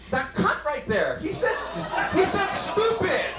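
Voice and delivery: dad imitates his daughter telling on him, British accent; mock British kid. Child in a put-on British accent, drawn out.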